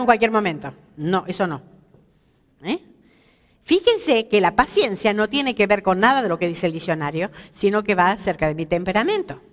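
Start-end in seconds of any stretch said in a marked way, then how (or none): none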